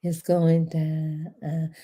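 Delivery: "It's going down" dragged out very slowly and low; lazy, savoring crawl; slow low drawl. Shown as a demonstration. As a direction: draw out "It's going down" almost hummed. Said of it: "Let me say it like this".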